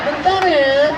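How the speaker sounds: high, teasing sing-song; mock coquettish. Shown as a demonstration. Mimicking a mother whose phone voice unsettles men, mock flirtatious. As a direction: stretch it out, playfully seductive. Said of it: "Itu yang membuat laki-laki di sana error".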